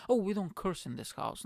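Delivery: high-pitched mock piety; mocking prissy imitation. Non-curser laying down house rules, mocked in high voice.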